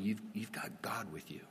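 soft whisper of comfort; whispered reassurance. Real, not acted. Preacher reassuring a fearful listener, whispered. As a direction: lean in and whisper it.

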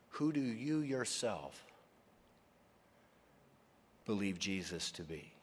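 "you yourself" leaned on, then falling; stress on you yourself. Real, not acted. Preacher to each listener, earnest stress. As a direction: make it personal. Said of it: "most important question".

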